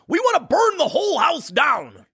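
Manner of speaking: mock Democrat cheering the burn. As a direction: caricature the radical, gleeful. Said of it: "When Democrats are like".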